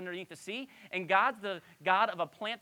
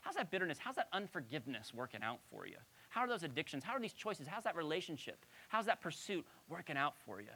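cruel friendly; 8 dB quieter than usual. Retold or imitated. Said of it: "in a kind of a cruel, friendly way".

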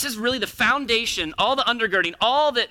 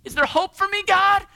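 high, louder plea; pleading; 11 semitones higher, 5 dB louder than usual. Acted. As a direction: ask with desperate hope. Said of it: "pleading sinner".

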